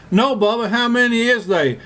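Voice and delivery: high hollered question; mock scream. Forrest yelling back at the dummy, high mock scream. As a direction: holler it up high over the motor. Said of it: "And I screamed".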